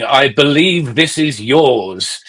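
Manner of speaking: slow, deliberate, dry delivery. Retold or imitated. Handing back a forgotten book, slow and dry. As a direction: draw each word out like an aloof singer. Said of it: "That's a good impression".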